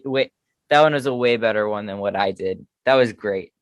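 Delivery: usual speaking voice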